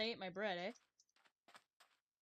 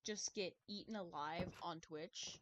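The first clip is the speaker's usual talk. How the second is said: normal talking voice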